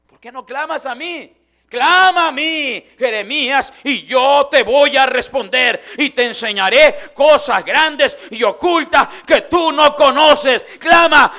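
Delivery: God calling Jeremías out of the cistern, booming and slow. Acted. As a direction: preach God's command at full force, stretching each word. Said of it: "la voz providencial de Dios, aleluya, y le dijo".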